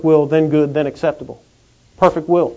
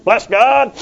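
mock self-satisfied believer, higher and louder; hammed-up smug believer, higher and louder; smug churchgoer; 4 semitones higher, 4 dB louder than usual. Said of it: "we're like".

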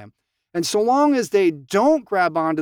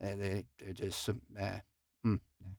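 much lower, much softer, under the breath; dismissive inner mutter; 10 semitones lower, 12 dB quieter than usual. Retold or imitated. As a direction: mutter it like a private judgment. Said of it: "we're like".

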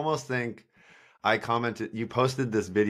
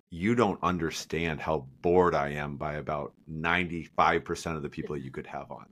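bored inner voice; 5 semitones lower, 3 dB quieter than usual. Retold or imitated. Inner thought, bored of guests.

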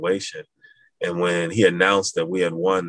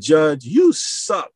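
higher, louder, very slow command; officer demoting the recruit leader, loud slow order; barked order; 10 semitones higher, 4 dB louder than usual. Retold or imitated. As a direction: dress him down. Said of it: "He said".

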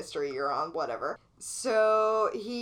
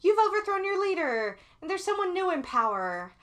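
mocking US voice; 4 semitones higher, 4 dB louder than usual. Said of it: "which sometimes was like".

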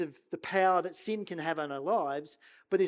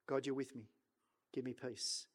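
much softer and lower, barely voiced; hushed prayer; 6 semitones lower, 12 dB quieter than usual. Preacher voicing a quiet prayer amid conflict, hushed. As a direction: pray it quietly under your breath.

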